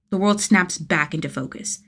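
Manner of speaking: ordinary delivery, nothing marked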